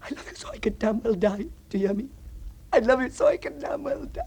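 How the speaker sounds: desperate. Acted. Desperate repeated declaration.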